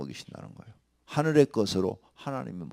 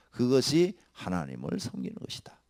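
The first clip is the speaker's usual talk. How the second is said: ordinary delivery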